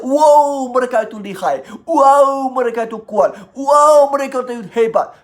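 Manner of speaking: hammed-up wonder, each wow swelling then easing off; awed admiration. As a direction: gush like an impressed onlooker. Acting awed onlookers admiring the mighty.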